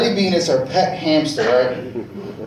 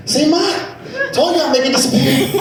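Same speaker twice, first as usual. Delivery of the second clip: put-on proud kid; 8 semitones higher, faster than usual. Young boy bragging to his mother beside the burnt house, cheeky. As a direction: show off to mom like a proud little kid. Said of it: "my fat ass outside".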